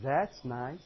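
a dull, bored "That's nice"; mock-bored wife barely looking up; flat, unimpressed wife. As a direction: brush it off softly, low and uninterested. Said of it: "say things like this".

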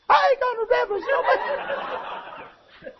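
laughing mock of a defiant wife; defiant woman, mocked. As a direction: ham up the stubborn wife. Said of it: "they say it like this".

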